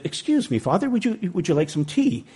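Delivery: soft, high-pitched nun voice; polite nun. Retold or imitated. Nun offering tea to a supposed priest, soft polite imitation. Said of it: "one nun came to Gary and said".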